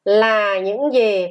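slow, drawn-out question; model reading. Shown as a demonstration. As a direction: stretch each word for pupils to repeat. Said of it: "Các con đọc". (đọc mẫu chậm, kéo dài)

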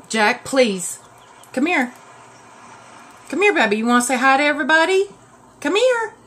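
high, loud coaxing pet voice, rising as she pleads; calling the dog. Real, not acted. Owner calling her anxious dog Jack to come say hi, high coaxing pet voice.

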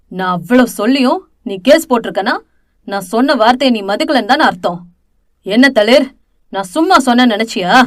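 hammed-up villain rage; menacing anger. A narrator's reading. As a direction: lean in and bully her. Villain threatens her on the phone.

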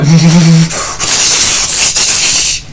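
mouth-made lightsaber hum and swooshes; imitated lightsaber noises. Reviewer mimicking Darth Maul's lightsaber fight, mouthed sound effects. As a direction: buzz and whoosh like a swinging lightsaber. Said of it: "Darth Maul's like".

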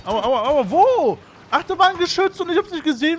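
high, loud, fast ouch cries; pained yelps. Real, not acted. Gamer hit by an unseen turret, yelping high and fast. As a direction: yelp as the turret hits you.

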